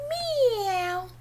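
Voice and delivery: high, drawn-out kitty meow; high meow sliding downward; cat meow. Acted. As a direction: mew like a little cat. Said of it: "Kitty cat".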